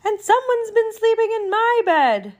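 high, indignant mother bear; medium-sized Mama Bear. Acted. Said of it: "said the Mama Bear in her medium-sized voice".

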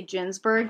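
"Ginsberg" starting with a J, like the name Ginny; soft G. Shown as a demonstration. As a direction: say "Ginsberg" as "jins-berg". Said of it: "I'm always inclined to say Ginsberg".

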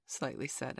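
plain reading voice

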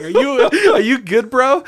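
high, loud question through laughter; laughing, pitched well up; laughing concern. Retold or imitated. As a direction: ask it while cracking up. Laughing while retelling checking on friend.

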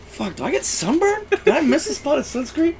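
high-pitched mock bewilderment; baffled. Retelling his puzzled morning-after reaction.

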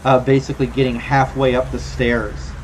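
ordinary delivery

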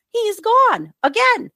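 high, loud and slow in disbelief; incredulous. Real, not acted. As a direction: stretch it out, amazed he's gone. Exasperated disbelief.

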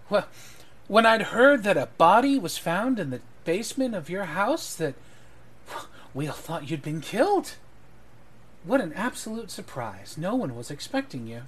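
high, swooping mock amazement; theatrical astonishment. Eleanor tells the lord they thought him killed, theatrical astonishment. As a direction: gush with exaggerated surprise, pitch rising and falling. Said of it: "Eleanor goes".

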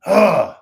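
loud, high grunt of disgust; disgusted groan. Real, not acted. Fed up with stupid gang followers, loud disgusted groan. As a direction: burst out with a loud "ugh".

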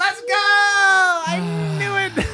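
drawn-out victory shout; triumphant shout. Real, not acted. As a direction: yell it out, drawn-out and high. Guessed right, celebrates.

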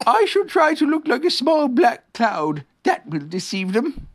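slow, high bear voice; Pooh bear, scheming. Acted. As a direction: announce a plan slowly as a high-voiced bear.

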